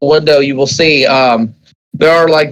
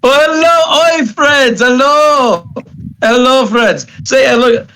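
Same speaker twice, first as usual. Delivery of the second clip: much higher, sing-song hellos; Daryl's sing-song greeting; 7 semitones higher, slower than usual. Acted. Daryl running to the peacekeepers, high sing-song greeting. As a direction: greet them like an overeager child.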